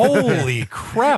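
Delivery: genuinely impressed exclamation; amazed. Real, not acted. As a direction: stretch it out in real amazement. Amazed at beer knowledge.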